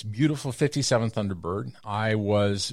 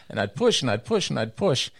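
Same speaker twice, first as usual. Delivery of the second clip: ordinary talk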